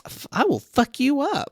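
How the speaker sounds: high-pitched comic threat; hammed-up aggressive warning; mock threat. Acted threat from character.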